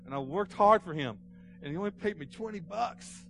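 high, loud acted indignation; angry outburst. Cheated ninth grader protesting his small pay, acted angry outburst. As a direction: cry out like a cheated kid. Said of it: "I was so mad, so angry over that".

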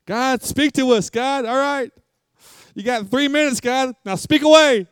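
high, bossy, hammed-up demand to God; high mock-impatient pray-er; mock impatient prayer. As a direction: parody someone giving God three minutes. Mocks impatient hurried prayer.

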